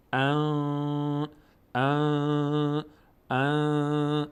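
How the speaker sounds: ان sung through the nose with the tongue idle; nasal ghunna on an. Shown as a demonstration. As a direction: hum the n through the nose, held. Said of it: "چطوری؟".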